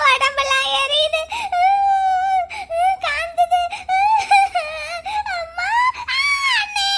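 high, drawn-out put-on sobs; fake wailing in pain. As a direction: wail as if burning all over. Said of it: "கதறி கதறி அழுதுகிட்டு இருக்கா".

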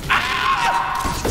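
high sudden scream; panicked scream. Real, not acted. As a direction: shriek in shock. Nearly killed.

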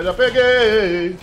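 loud, slow drawn-out cry; triumphant shout. Real, not acted. Streamer landing the grab, slow triumphant shout. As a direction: stretch it out in triumph.

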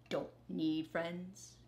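stubborn grumble; grumbling. Acted. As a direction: mutter it to himself, stubborn. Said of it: "grumbled Rat".

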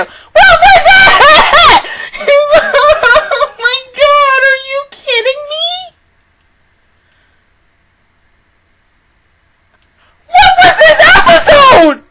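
high, loud shriek through laughter; shrieking disbelief. Real, not acted. Stunned by the episode, shrieking and laughing in disbelief.

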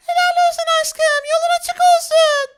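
very high falsetto send-off; squeaky put-on admiring spouse; wife's voice. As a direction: play the wife cheering him off. Wife cheers him off.

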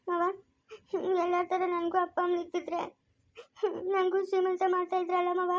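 soft crying plea to her uncle; tearful lament. Acted. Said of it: "ಯಾಕ ಚಿಲ್ಕ ಅಳ್ತಾ ಇರೋದು".

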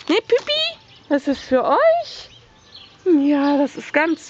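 very high cooing; pet talk. Acted. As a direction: coo sweetly at the kitten. Cooing at cat.

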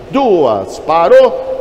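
high, loud hammer call. Real, not acted. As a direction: call the close of bidding out loud. Auctioneer closing the bidding.

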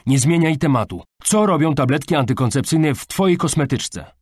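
stern father losing patience; angry confrontation. A narrator's reading. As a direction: interrogate your daughter angrily. Father confronts daughter in quarrel.

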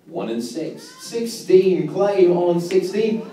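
steady sing-song number calling; bingo caller. Acted.

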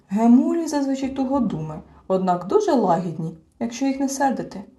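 ordinary delivery, nothing marked

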